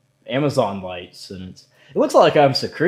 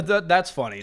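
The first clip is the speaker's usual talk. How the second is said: usual speaking voice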